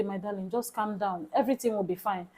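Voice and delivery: calm her down softly; soothing comfort. Retold or imitated. Comforting the crying young mother, soft and tender.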